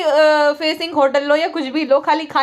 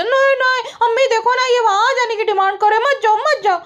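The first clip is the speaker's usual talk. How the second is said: high, whiny-style imitation of pleading; mock pleading; 8 semitones higher than usual. Vlogger mimicking her stopping him from going, mock pleading. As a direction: mimic her objecting in a much higher voice.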